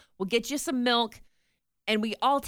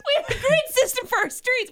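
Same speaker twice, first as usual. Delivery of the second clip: laughing; 13 semitones higher than usual. Real, not acted. Laughing mock-proud repeat.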